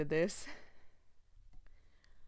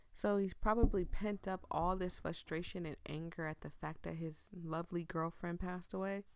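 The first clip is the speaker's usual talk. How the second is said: normal talking voice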